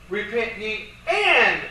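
slow quote, "and" punched hard; stress on and. Shown as a demonstration. Lecturer showing the two commands are tied together, stress on "and". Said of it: "tied together by the word".

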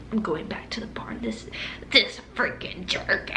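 imitated angry grumble under the breath; mad muttering. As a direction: grumble it half-whispered, as if to yourself. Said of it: "And I was so mad".

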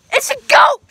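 yelling. Real, not acted. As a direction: shout it in alarm. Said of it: "stop yelling".

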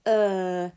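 teacher demonstrating the er sound; phonics sound er. Shown as a demonstration. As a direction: clear er sound. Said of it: "that's the sound that we're doing".

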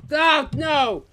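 high, loud "busted" cry; over-the-top cry of dismay; mock dismay. Mock panic at being found out.

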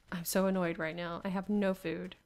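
ordinary talk, nothing marked

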